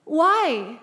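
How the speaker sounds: high, loud, startled questions; thrown, voice jumping up high; shocked disbelief. Retold or imitated. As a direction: retell being caught completely off guard. Acted shocked reaction to valet's question.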